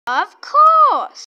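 high, loud cheerful "of course"; eager shout. Pretend. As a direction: burst out happily. Shopkeeper toy answers eagerly.

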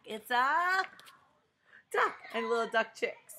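bright excited answer; excited reveal. Acted. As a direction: announce the duck with glee.

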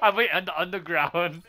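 laughing while talking. Real, not acted. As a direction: laugh through the words. Laughing at own play.

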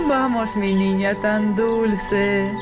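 slow, tender lulling. Real, not acted. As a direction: soothe her toward sleep. Lulling the child to bed.

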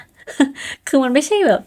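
giggling about her odd hat; amused laugh spilling into words. Real, not acted.

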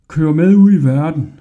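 loud jeering cry; mocking shout. A narrator's reading. Porters jeering at Hodja, loud mocking shout. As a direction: bellow it back, laughing at him. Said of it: "råbte de og grinede".

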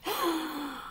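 quick high breathy gasp; sharp gasp. A narrator's reading. Jack gasping at the valley of nests, sharp and shocked. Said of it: "he gasped".

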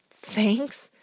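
"Thanks?" echoed with a rising, disbelieving tune; mock your own word back at yourself; incredulous self-mockery. A narrator's reading. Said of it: "Eric kicked himself for saying it".

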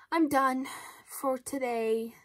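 slow, worn-out mock exhaustion; slow exhausted goodbye; drained sign-off. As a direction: sigh it out like you're spent. Signing off tired.

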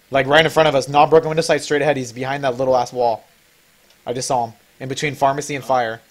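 normal talking voice

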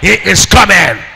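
from firm to a high, slow, loud cry; building shout. Acted.

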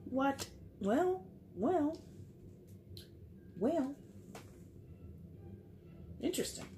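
high, drawn-out well, well; intrigued at what the cards show; surprised. Real, not acted.

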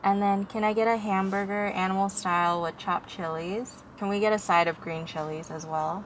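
muffled by cloth mask; speaking behind mask; through a face mask. Real, not acted. Seen 1: Cloth mask covers mouth and nose throughout drive-thru order.

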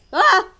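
loud high cry of alarm; startled yelp. Real, not acted. Player asking where stuff went, then loud yelp. Startled during game play.

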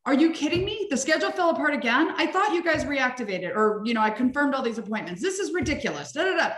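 high, exasperated ranting boss; mock outburst at a fallen-apart schedule; angry dentist rant. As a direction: rant like a fed-up dentist at his staff. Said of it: "There's two ways dentists can handle it".

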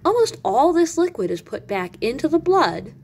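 ordinary talk

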